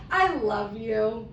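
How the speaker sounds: put-on slow, sweet kid voice; happy clueless girl. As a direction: imitate a dreamy little girl, words drawn out. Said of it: "She's just happy to be there".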